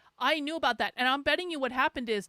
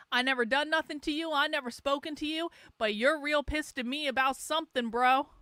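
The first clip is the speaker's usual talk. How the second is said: ordinary delivery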